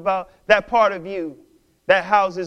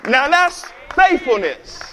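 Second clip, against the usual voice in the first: preaching shout; 6 semitones higher, 3 dB louder than usual. Real, not acted.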